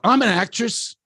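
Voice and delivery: high, loud blurt across the room; yelling out. Retold or imitated. Guest yells at the director on stage, loud blurt. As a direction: shout it out to the whole room. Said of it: "And she yells out".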